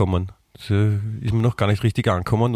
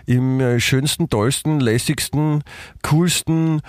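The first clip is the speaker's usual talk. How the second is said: upbeat show-opener announcement; announcer voice; 4 semitones higher than usual. Acted. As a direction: announce the episode like a host. Podcast host opening announcement.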